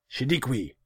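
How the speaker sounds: high, loud summons; calling out. A narrator's reading. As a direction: call a servant across the room. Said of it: "he called".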